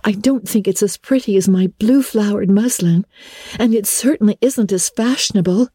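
girl doubting her dress, lightly voiced; lightly voiced girl Anne. A narrator's reading. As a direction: lift the voice for an uncertain girl.

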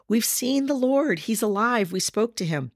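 excited announcement, easing down; excited disciples. Acted. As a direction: voice the disciples' excitement, starting high. Disciples excitedly tell Thomas the news.

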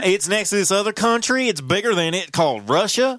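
mock a simplistic explainer, badly impersonated; Kamala Harris. Host mimicking the vice president's lesson on Russia, terrible impression. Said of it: "being terrible at impressions".

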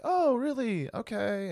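high-pitched, guarded small-talk reply; polite noncommittal interest. Retold or imitated. Passenger humoring the driver without befriending him, noncommittal. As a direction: imitate keeping a conversation politely going. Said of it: "but not being his friend".

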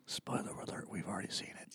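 hushed conspiratorial aside; whispering. Real, not acted. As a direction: whisper it like a secret.

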